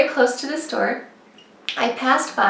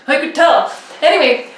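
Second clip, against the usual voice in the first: normal talking voice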